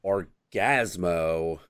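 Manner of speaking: low, slow mock entrance; half-hearted imitation of a heroic cry; deflated hero announcement. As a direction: let the superhero's name sag, drawn out. Deflated second take of entrance.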